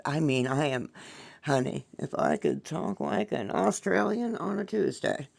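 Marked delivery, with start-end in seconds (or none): 1.4-5.3 light put-on Australian accent, a bit higher; playful attempt at an Australian accent; teasing stab at an Aussie accent. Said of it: "I love accents".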